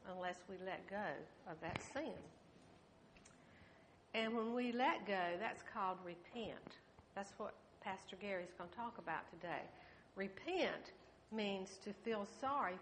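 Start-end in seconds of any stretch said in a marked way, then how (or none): none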